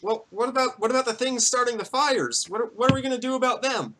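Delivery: high-pitched anxious question from the crowd; high, fretful townsperson; worried villager. Acted. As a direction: pipe up high, pressing for answers.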